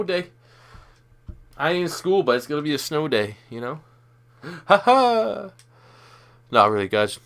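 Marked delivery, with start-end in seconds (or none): 4.7-5.5 high, breathy "ha ha"; short laugh. Real, not acted. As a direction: laugh at your own joke. Mock laugh at own joke.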